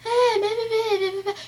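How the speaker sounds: high, sing-song blah blah; mocking mom chatter. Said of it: "She was like".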